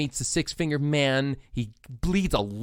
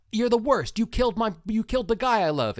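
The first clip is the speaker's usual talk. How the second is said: much higher, accusing princess voice; mock Buttercup; 11 semitones higher than usual. Buttercup blaming the masked man, high mock-accusing voice. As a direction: play her hurt and furious. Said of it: "Buttercup goes".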